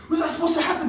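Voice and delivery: high, loud incredulous question; mock-panicked squawk; retold alarm. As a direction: act out a freaked-out demand for answers. Retold alarmed question after escape.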